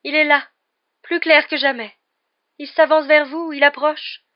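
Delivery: ominous prophecy. A narrator's reading. Trelawney foretelling the Grim to Harry, high loud portentous voice. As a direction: announce doom like an oracle.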